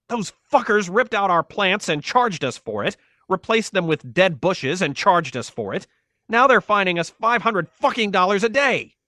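high-pitched, outraged rant; angry shout. A narrator's reading. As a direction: rant at full indignation. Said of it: "he shouted".

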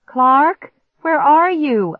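high, loud searching shout; urgent, panicked call. A narrator's reading. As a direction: shout for someone out of sight. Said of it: "I shouted".

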